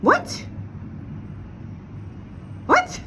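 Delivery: can't believe it, squeak it out; incredulous what. Real, not acted. Venting vlogger stunned by her friend's apologies, incredulous.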